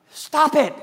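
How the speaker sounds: "Stop it" barked high and loud; "Stop it" shouted sharply, high and loud; firm command. Acted. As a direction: slam down "Stop it" as an order. Preacher commanding congregation.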